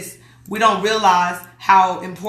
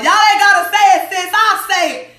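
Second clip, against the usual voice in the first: emphatic excitement; 10 semitones higher, 6 dB louder than usual. Real, not acted. Speaking up for the group admins.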